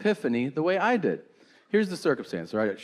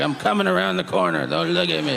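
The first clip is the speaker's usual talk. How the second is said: put-on goblin old man. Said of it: "He's like".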